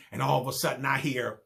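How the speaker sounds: ordinary talk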